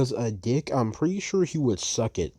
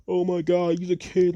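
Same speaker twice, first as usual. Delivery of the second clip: mock gasp quote; 5 semitones higher than usual. Imitating Hyde's friends defending him, mock outraged and higher. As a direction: play a shocked, whiny defender. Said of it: "Hyde friends that'll be like".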